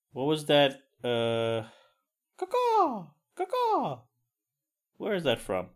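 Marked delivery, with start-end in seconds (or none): 2.4-4.0 very high squawked bird call; Kramer caw-caw. Retold or imitated. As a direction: screech it out like a crow. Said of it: "Kramer comes to mind for this".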